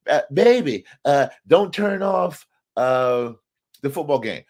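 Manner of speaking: gently imitating a sweet-voiced wife. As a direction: lightly voice his sweet-talking wife. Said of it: "She has a very sweet voice".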